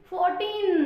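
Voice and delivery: फोर्टीन said loud and clear, ending firm on N; clear pronunciation. Shown as a demonstration. Teacher having the class pronounce फोर्टीन, loud and clear. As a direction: call out फोर्टीन crisply. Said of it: "pronounce कीजिए".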